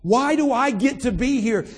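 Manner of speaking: ordinary talk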